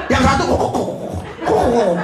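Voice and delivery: mock snore noises, kok kok; playful snoring sounds; snoring imitation. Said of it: "menikmati ngorok mereka".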